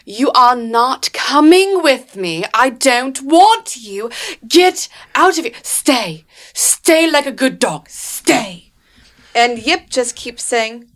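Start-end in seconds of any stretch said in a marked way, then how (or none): 0.0-8.7 loud, drawn-out, higher-pitched acted yell. GM as Claudia yelling at Yip to stay, yelling. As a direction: yell each command slowly and forcefully. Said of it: "You hear her yelling".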